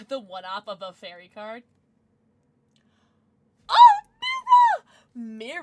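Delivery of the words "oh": excited shriek. Real, not acted. Pulls a wanted card.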